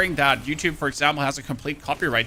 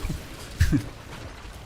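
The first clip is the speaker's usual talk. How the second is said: breathy laugh; 4 dB quieter than usual. Real, not acted. Laugh after bit.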